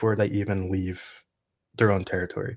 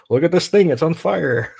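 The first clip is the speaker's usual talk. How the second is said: mock excited soldier; 8 semitones higher, 6 dB louder than usual. Mocking soldiers filming a burning vehicle.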